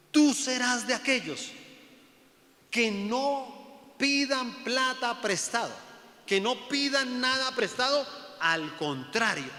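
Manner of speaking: lifted, drawn-out prophetic proclamation; proclaiming promise. Acted. Preacher declaring God's promise to the congregation, lifted and slow.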